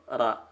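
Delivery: RA with no lip rounding, tongue only; RA through a smile. Shown as a demonstration. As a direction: say RA with spread, smiling lips. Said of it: "ucapkan huruf ra tapi sambil senyum".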